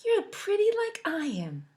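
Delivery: high, pleased with herself; a proud, high compliment; Milk flattering Cupcake and herself; vain girl. Acted. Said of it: "Milk said".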